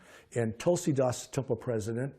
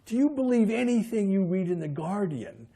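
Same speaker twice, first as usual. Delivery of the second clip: mocking disbelief; 9 semitones higher, 6 dB louder than usual. Skeptical officer mocks the claim.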